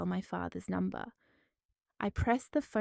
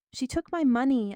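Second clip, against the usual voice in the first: loud, higher panicked scream; 5 semitones higher, 7 dB louder than usual. A narrator's reading. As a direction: scream it out as control shatters. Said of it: "I finally screamed".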